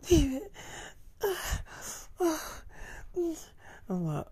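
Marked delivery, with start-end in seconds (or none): 0.1-0.5 high airy sigh then his name, much higher; imitating a girlfriend's high sigh; mock pleasure sigh.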